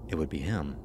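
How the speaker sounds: plain reading voice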